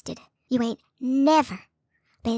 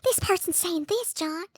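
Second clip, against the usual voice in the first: mocking Julie; 3 semitones higher, slower than usual. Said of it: "running up to you".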